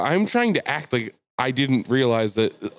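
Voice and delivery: ordinary talk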